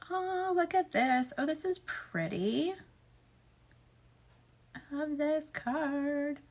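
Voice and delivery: soft, slow, absorbed murmur; hushed admiration. Real, not acted. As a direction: admire it quietly, almost to yourself. Admiring a card just drawn.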